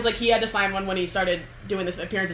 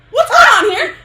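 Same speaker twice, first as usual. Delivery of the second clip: mock shout; 13 dB louder, faster than usual. [name] finds Henry in closet.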